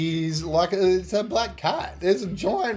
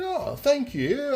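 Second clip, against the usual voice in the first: surprised thanks; 11 semitones higher, slower than usual. Retold or imitated. Playing along, accepting money not his.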